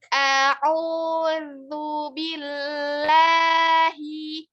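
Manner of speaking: A'udzu billahi drawn out very slowly; slow tajwid recitation. Shown as a demonstration. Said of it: "lidahnya harus keluar".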